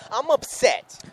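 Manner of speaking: high, strained and plainly fed up; upset. Real, not acted. Co-host repeating to Big Al that he's upset. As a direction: say it like you can't let it go. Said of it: "as you can hear the tone in my voice".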